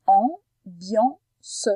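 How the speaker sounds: "ambiance" in three pieces, am, bian, ce; distinct pause after each syllable of "ambiance"; split into syllables. Shown as a demonstration. Teacher breaks down the word, "ambiance" syllable by syllable. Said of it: "c'est dans la deuxième syllabe".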